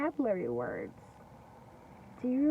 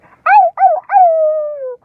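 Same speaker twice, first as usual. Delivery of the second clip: much higher, louder yelps; yelling wolf; 16 dB louder, much slower than usual. Acted. Wolf yelling after falling into the hot water, very high and loud. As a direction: yelp in pain. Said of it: "he yelled".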